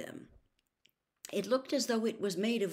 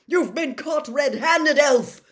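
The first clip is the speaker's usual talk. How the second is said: slow, loud, raised accusation; roared; 8 semitones higher, 12 dB louder, slower than usual. A narrator's reading. Official accuses the cowering elf, furious roar. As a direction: roar it down at the culprit. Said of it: "Mister Diggory roared".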